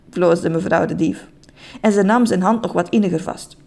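ordinary delivery

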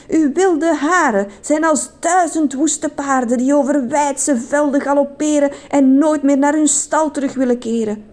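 high, breathless-with-love mock declamation; hammed-up lovestruck poet; passionate. A narrator's reading. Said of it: "ging vol passie verder".

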